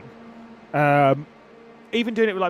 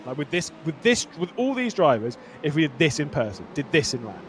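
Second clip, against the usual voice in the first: ordinary talk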